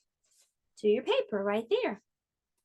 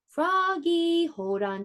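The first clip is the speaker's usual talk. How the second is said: higher, slow called-out warning; a dad letting go of the bike, calling out; yelled warning; 7 semitones higher, slower than usual. A narrator's reading. Dad yells to Froggy on the wobbling bike, high and slow. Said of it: "he yelled".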